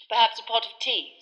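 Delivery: politely firm, wanting to move on; Fudge, testy. A narrator's reading. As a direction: Fudge hustling Harry inside. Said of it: "said Fudge".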